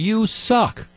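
high-pitched mock taunt; mock heckler's yell. Fan heckling Christie at the ball game, mock yell. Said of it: "who's yelling at him".

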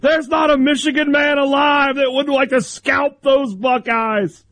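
high melodramatic shouting play-by-play voice; booming old-time announcer. Retold or imitated. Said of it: "It's like".

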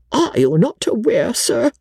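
high-pitched, anxious lady's voice; flustered companion. A narrator's reading. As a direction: address him in high, fretful politeness. Timid Briggs confronts Sir Pitt.

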